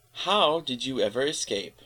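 "How" jumps up high, "escape" falls away; rise then fall intonation. Shown as a demonstration. Reader demonstrates global rise and fall, rise-fall intonation. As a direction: lift "How" high and loud, then drop through "escape". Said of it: "global rise symbol is placed before the transcription".

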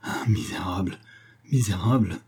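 whispered gasp, breaking into voice; choked, breathy outrage in fragments; panting stammer. A narrator's reading. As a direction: force the insult out breathlessly. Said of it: "balbutia par phrases rapides, hachées, en haletant".

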